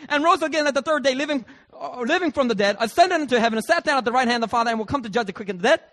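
loud, high officiant voice racing on; booming pastor voice. Retold or imitated. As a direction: rattle off the creed like a pastor. Pastor voiced, rushing through creed.

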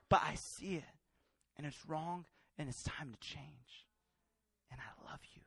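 low and very soft, fading nearly to a whisper; hushed, tender. Retold or imitated. God speaking privately to young Joseph, hushed tenderness. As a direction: sink into a quiet, loving correction. Voicing God's gentle words to him.

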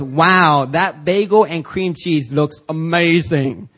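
slow, admiring waitress voice; a lightly voiced waitress, drawn out. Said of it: "And she goes".

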